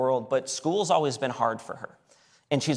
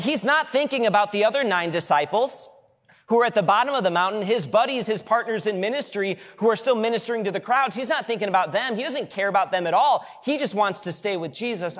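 fervent preaching; 7 semitones higher, 4 dB louder than usual. Real, not acted. Preaching at full force.

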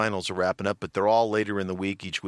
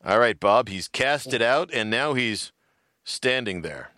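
raised-pitch announcer play-by-play, slowing to a letdown; mock sports announcer; 4 semitones higher than usual. Poking fun at fishing coverage, mock announcer. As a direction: build up the cast, then let it drop. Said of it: "when you're the announcer".

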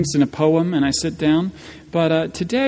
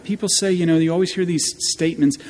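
normal talking voice

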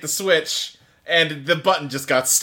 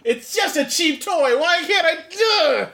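frustrated mock Ryusei; 10 semitones higher, slower than usual. Mocking Ryusei failing at switch.

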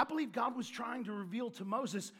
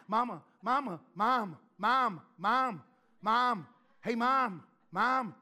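put-on a child nagging mama over and over, loud; whiny kid calling; 6 dB louder, slower than usual. Niece calling her mom on the phone, repetitive loud whine. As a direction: keep calling like an ignored little kid.

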